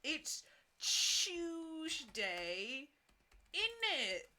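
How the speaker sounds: very high, exaggerated British accent; hammed-up high British, with long pauses. Shown as a demonstration. Showing off the villain's accent, high mock British. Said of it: "Tuesday British accent".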